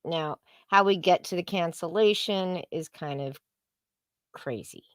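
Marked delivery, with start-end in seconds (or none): none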